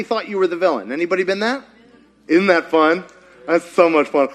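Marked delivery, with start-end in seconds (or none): none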